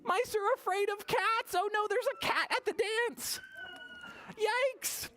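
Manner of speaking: high squeaky fearful mice voice; mock fright. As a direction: squeal in playful panic. Scared mice voice.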